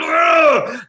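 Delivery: one sharp burst of built-up annoyance; frustrated ugh. Acted. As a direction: spit out a fed-up groan. Venting pent-up frustration.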